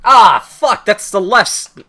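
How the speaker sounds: high, loud annoyed exclamation; frustrated outburst. Real, not acted. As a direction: curse at the realization. Realizes mistake.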